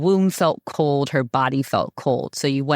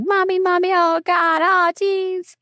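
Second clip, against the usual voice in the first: put-on much higher whiny children tugging at mommy; whiny kids; 3 dB louder than usual. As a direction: imitate the boys pleading for mom, high and needy. Kids tugging at mom's skirt.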